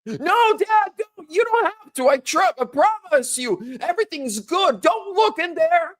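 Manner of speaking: mock panicked kid begging dad; pleading teen son. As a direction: beg your dad not to look, voice shooting up. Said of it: "How would I react?".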